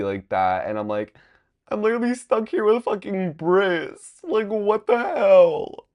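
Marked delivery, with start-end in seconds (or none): 1.7-5.9 high, loud panicked yelling. Retold or imitated. To his friends, stuck at the scary farm with Briss, a high panicked yell. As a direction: squeal it like you're trapped and freaking out.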